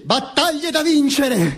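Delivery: high, drawn-out rally cry; oratorical declaiming. Acted. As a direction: declaim it like a balcony speech, slow and high. Tirade acted as Mussolini orator. (declamazione da comizio, acuta e strascicata)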